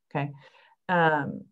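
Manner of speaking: ordinary delivery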